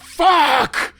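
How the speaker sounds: very high, loud yelled curse; raw scream. Acted. Injured man screaming a curse in the store, raw yell. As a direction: let it rip.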